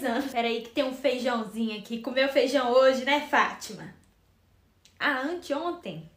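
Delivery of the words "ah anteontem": talking with mouth held open; mouth propped open. Acted. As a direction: speak through a wide-open mouth. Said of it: "você não pode falar agora".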